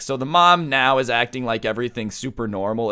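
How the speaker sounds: ordinary talk, nothing marked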